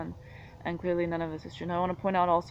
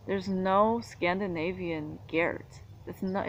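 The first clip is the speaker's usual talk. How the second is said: ordinary delivery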